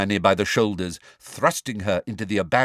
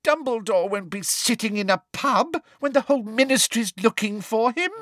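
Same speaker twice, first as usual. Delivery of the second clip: scolding; 13 semitones higher than usual. Acted. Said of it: "shouted Umbridge".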